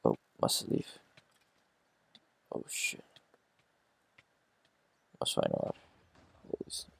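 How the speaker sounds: hushed, urgent whisper. Pretend. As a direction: breathe it out under your breath. Fleeing enemies, hushed voice.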